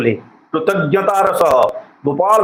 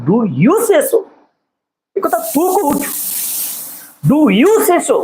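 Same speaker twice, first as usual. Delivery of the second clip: a much higher, relieved patient's voice; the sick man asks, lifted by hope; tone of relief; 10 semitones higher, slower than usual. Acted. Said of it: "He asked in a tone of relief".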